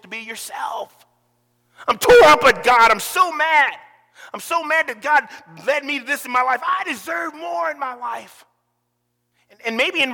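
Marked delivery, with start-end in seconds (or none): 1.9-8.4 loud, bitter shout of grievance; a believer yelling his grievance at God; angry at God. Pretend. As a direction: rail against God, loud then sulky. Voicing someone mad at God.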